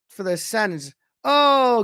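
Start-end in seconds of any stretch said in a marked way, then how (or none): 1.2-1.8 loud, high, drawn-out cry; real gasp of amazement at a save; excited reaction. Real, not acted.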